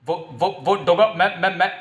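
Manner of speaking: terrified stammer. Acted. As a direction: stumble over each word in fear. Cornered criminal stammers before Doga.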